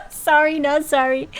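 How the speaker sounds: loud laugh into a cheeky sorry, not sorry; laughing tease. Real, not acted. Streamer teasing a viewer over a pun, laughing.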